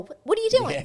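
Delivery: high-pitched indignant scolding; Lois. Retold or imitated. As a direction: scold someone as if smacking their head. Voices Lois scolding someone.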